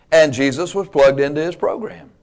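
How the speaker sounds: ordinary talk, nothing marked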